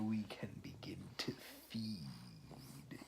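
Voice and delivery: slow, hushed, menacing whisper; sinister whisper. Acted. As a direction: let the threat creep out in a whisper. Walrus reveals he'll eat them.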